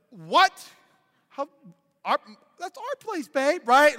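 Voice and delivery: mock disbelief. Husband to wife about their restaurant, stammering disbelief. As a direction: splutter in put-on protest, voice jumping up. Acts out his dismay to wife.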